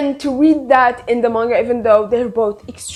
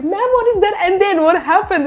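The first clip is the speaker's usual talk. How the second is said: excited question; 8 semitones higher than usual. Retold or imitated. Speaker retells excited question.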